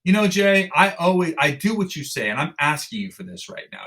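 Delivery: lightly voiced teammate asking a favour; imitating a plea. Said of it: "Angus can be like".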